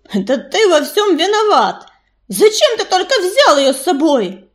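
indignant, rising accusation; angry accusation. A narrator's reading. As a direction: lash out in blame. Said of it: "глаза его полыхнули гневом".

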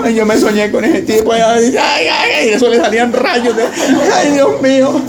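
high squealing ay ay ay with a laugh; mock frightened dream retelling. Churchgoer retelling her nightmare about the preacher, in a high mock shriek.

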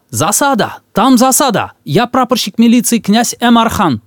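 high alarmed yell from afar; enemy spotted shout. Acted. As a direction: shout the warning at full voice. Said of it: "Он издали закричал".

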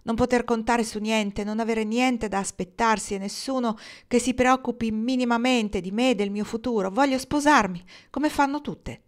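anguished outburst. A narrator's reading. Rosabel pleading with Kendra, rising anguished outburst. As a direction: pour out her despair rising. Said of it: "esclamò".

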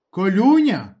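high, loud angry call; agitated yell. A narrator's reading. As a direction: shout the name, wound up with anger. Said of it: "задержав від хвилювання і гніву".

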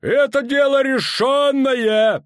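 high, loud, slow shout. A narrator's reading. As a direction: slam the decision down. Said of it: "повторил Данза". (громкий окрик)

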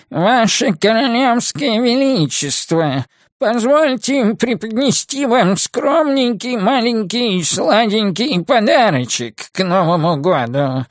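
put-on high, slow, quavering old man's nasal voice; frail breathless old person. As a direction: play a doddering old courtier bowing grandly. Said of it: "прогнусавил дребезжащим старческим голосом". (дребезжащий старческий гнусавый голос)

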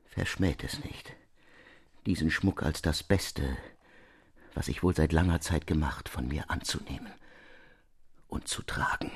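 a pleading offer that dies away to breath; fading plea. Acted.

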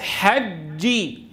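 very slow حجي, held hard closure on the doubled ج; strong voiced jeem. Shown as a demonstration. Teacher models for repetition, slow firm ج in حجي. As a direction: lock the sound on ج in حجي, then release. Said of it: "بننطقها شديدة مجهورة".

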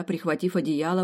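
usual speaking voice

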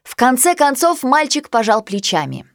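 plain reading voice

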